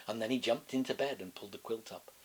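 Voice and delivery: usual speaking voice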